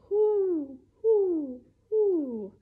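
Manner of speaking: high, loud owl hoots. Pretend. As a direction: hoot like an owl at night. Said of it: "like an owl".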